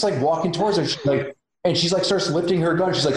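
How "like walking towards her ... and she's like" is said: usual speaking voice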